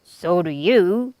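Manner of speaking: slow, gruff retort; grumpy thief. A narrator's reading. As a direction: snap it back sulkily. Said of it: "Hugh said".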